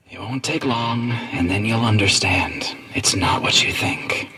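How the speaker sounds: slow creepy whisper; sinister whisper. Acted. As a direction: whisper it slowly and menacing. Villain speaks in whisper.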